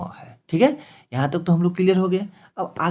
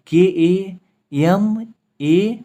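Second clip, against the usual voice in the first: slow spelling; 4 semitones higher, 5 dB louder, much slower than usual. Shown as a demonstration. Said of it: "इसके लिए जोर पड़ रहा है".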